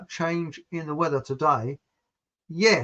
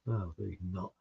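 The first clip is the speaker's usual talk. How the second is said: low, much softer aside; quiet aside; 9 semitones lower, 13 dB quieter than usual. Retold or imitated. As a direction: mutter the truth under breath. Aside undercutting the false yes.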